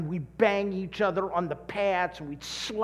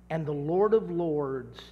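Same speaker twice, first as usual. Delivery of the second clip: ordinary talk, nothing marked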